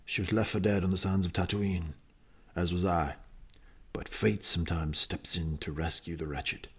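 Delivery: put-on low, rough-edged New Zealand accent, lightly done; hammed-up New Zealand accent impression of Boba Fett. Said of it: "Not a great impression".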